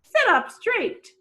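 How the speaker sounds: high, loud, slow prim instruction; a fussy lady correcting manners; prim guardian. Acted. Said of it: "Angela taught Old Tom how to behave".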